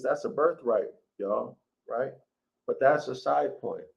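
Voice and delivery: ordinary delivery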